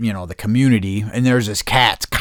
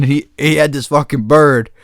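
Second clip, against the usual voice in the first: ordinary talk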